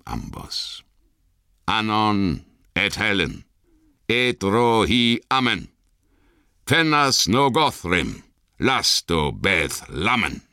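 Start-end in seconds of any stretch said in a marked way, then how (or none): 1.7-10.4 solemn commanding incantation. A narrator's reading. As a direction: intone the spell with authority. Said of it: "sagte er in gebieterischem Ton".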